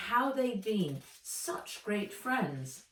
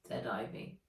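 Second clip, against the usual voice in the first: plain reading voice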